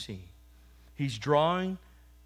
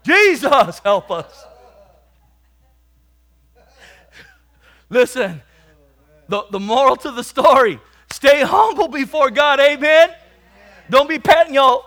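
much louder, higher preacher's cry; full-force preaching; 10 semitones higher, 15 dB louder than usual. Acted. Preacher exhorting the congregation to stay humble, at full force. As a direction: drive the moral home to the congregation.

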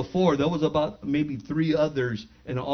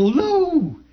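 higher, drawn-out friendly call; 10 semitones higher, 3 dB louder than usual. Retold or imitated. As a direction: call out to a buddy across the lot. Calling out to a friend.

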